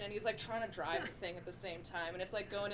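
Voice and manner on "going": ordinary talk, nothing marked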